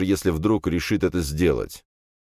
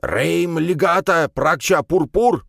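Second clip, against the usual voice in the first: high, slow, forceful spell-casting; incantation cry; 12 semitones higher, 4 dB louder, slower than usual. A narrator's reading. Old priest waves his staff at the spider, chanting loudly. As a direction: cry out each magic word, drawn out and raised.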